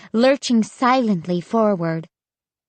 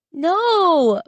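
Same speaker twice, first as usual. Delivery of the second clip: cried out; 9 semitones higher than usual. A narrator's reading. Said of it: "Mark cried".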